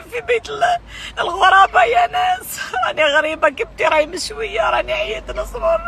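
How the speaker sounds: crying and shouting in distress; wailing plea, voice breaking; angry crying. Real, not acted. Woman pleading for injured brother.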